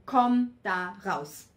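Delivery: loud, slow and firm; clear loud command. Shown as a demonstration. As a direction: order yourself out of it, slowly and loudly. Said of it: "Und ganz klar sagen".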